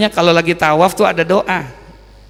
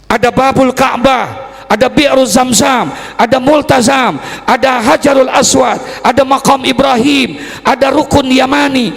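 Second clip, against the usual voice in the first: high, forceful list, each item ringing out; impassioned preaching; 8 semitones higher, 4 dB louder than usual. Real, not acted. Preacher listing the holy spots at the Ka'bah, impassioned. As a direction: proclaim each name to the congregation.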